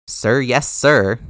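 loud, clipped military reply; mock salute. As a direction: bark it like a cadet.

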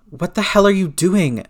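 high, loud, alarmed shriek. A narrator's reading. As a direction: shriek it in disbelief. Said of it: "May shrieked".

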